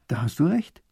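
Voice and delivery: plain reading voice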